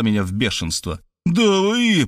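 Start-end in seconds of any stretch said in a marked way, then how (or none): 1.3-2.1 high, outraged outburst; thundering. A narrator's reading. Narrator enraged by Markhalyova's insults, thundering. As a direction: burst out, lost for words. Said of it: "прогремел я".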